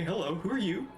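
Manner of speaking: normal talking voice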